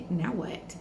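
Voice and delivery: barely audible now what; hushed inner question; under-breath thought. Retold or imitated. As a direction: murmur it to yourself, almost gone.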